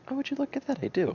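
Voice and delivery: high, playful fake surprise; mock surprise. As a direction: act amazed, tongue in cheek. Retells fake surprise at owning Jean.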